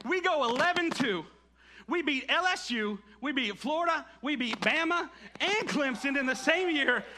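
high, rising fan excitement; excited boasting. Real, not acted. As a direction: build to a proud, loud finish. Preacher relives his team's winning season.

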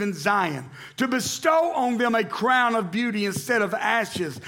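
scripture reading. Acted. Pastor reading Isaiah aloud to church, ringing. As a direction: proclaim the verses to the congregation.

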